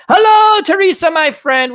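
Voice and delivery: loud, high sing-out greeting; booming high-pitched hail, easing down; calling out. Pretend. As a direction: holler a big hello, stretched and high.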